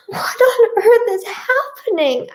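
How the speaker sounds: high-pitched dismayed cry; distressed cry. A narrator's reading. Narrator voices herself fleeing the cat, crying out high. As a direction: cry it out in disbelief. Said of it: "I cry and throw myself".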